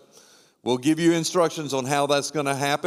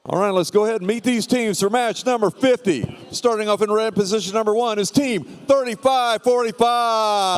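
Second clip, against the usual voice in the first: high, hyped-up arena announcer; 6 semitones higher, 3 dB louder than usual. Acted. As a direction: pump up the crowd announcing teams. Hypes the match line-up.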